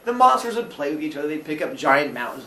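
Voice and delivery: ordinary talk, nothing marked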